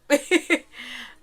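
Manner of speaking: high, light laugh; real chuckle. Real, not acted. Podcaster amused after own aside to listeners, high chuckle. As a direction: laugh at your own remark.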